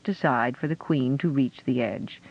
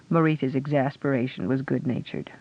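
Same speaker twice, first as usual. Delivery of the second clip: usual speaking voice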